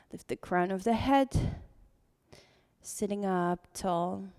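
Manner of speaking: calm voice easing the class into breath; guided meditation. Acted. Leads seated breathing meditation.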